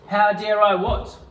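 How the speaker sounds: high, loud indignant comeback; indignant retort. Pretend. Actor retorting to partner's accusation, loud and indignant. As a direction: fire it back, offended. Improvised quarrel, indignant reply.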